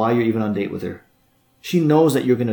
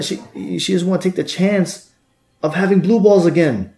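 normal talking voice

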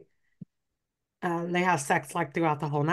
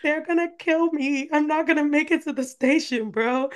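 high-pitched, lightly hammed-up dread; mock panic; 8 semitones higher than usual. Heroine sure father and son will kill her, mock panic. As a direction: wail it high in comic alarm, without shouting. Heroine fears a serial killer duo.

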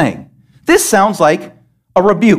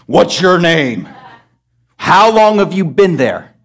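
slow, lower mock interrogation; mocking preacher; 5 semitones lower, slower than usual. Preacher mimicking YouTube preachers questioning demons, slow mocking tone. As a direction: parody a preacher quizzing a demon. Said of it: "preachers sitting there talking to demons".

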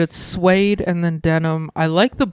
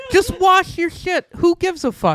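exasperated; 10 semitones higher than usual. Real, not acted. Exasperated rant about jeans.

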